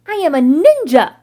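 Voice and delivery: loud proud battle cry; loud boastful ninja shout. A narrator's reading. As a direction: shout it like a little ninja leaping. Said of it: "he shouts".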